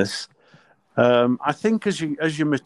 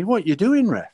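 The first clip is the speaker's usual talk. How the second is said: higher, louder acted challenge; indignant challenge; 8 semitones higher, 4 dB louder than usual. England captain challenging a new referee, indignant. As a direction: confront the ref, pitch jumping up.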